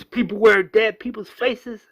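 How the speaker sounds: retold scream, loud and high. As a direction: cry it out as a frantic accusation. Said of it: "Morgan screams".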